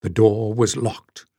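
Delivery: usual speaking voice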